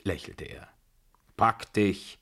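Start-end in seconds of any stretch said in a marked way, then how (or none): 1.4-2.2 mildly raised, cross dismissal; brusque old matriarch's cry; shouting. A narrator's reading. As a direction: snap it at him. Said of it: "schrie die Babuschka".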